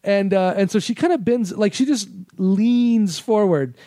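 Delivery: ordinary talk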